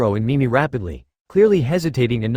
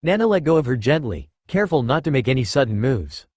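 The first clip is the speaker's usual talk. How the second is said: ordinary delivery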